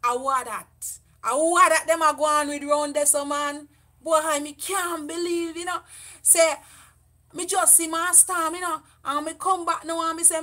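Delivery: a lightly acted Jamaican patois chatter. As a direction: slip into easy Jamaican patois gossip. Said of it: "acted patois gossip scene".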